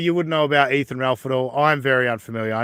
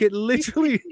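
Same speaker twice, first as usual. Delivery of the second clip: normal talking voice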